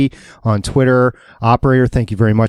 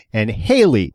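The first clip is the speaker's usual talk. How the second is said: normal talking voice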